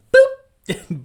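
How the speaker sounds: high, loud playful boop; silly meme sound effect; cartoon boop. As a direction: pop it like a cartoon bubble. Meme character pops anger.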